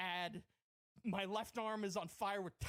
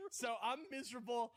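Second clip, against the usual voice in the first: ordinary talk, nothing marked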